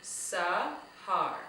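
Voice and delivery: "Sahar" in two slow, separated syllables. Shown as a demonstration. Teacher repeating the jaw exercise for children, "Sahar" said very slowly. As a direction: break "Sahar" slowly into two clear beats. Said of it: "you can try that again".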